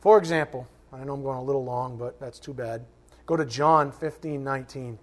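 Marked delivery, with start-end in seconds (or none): none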